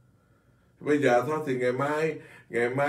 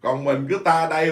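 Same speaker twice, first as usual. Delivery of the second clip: ordinary talk, nothing marked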